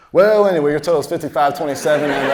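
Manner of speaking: loud, high cashier announcement; abrupt businesslike switch. Retold or imitated. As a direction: brush it off and ring them up loudly.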